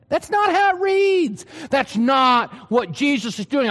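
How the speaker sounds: ordinary talk, nothing marked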